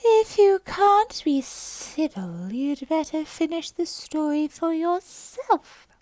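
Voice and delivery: high, slow, huffy mouse voice; sulky Dormouse. Acted. Offended Dormouse snapping at Alice, high and slow. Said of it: "sulkily remarked".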